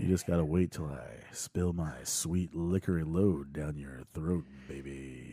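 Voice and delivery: low, very soft fake come-on; mock seductive. Host teases after an "erotic" remark, mock-seductive and hushed. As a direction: croon it low like a joke lover.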